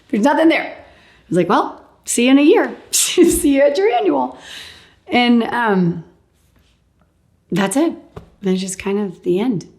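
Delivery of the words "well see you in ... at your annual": high, bright farewell with a laugh; cheery goodbye. Retold or imitated. Patient leaving the doctor, cheery and high. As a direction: wave them off brightly.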